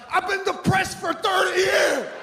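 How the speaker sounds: high, loud, exasperated father voice; mock father shout. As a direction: yell it like a fed-up dad. Father quoted on depression.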